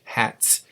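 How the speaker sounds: "Hats" whispered, with a hard ts; "Hats" unvoiced, stressing the final ts; whispered demo. Shown as a demonstration. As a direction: push air through the teeth on "Hats". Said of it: "blow air through your teeth".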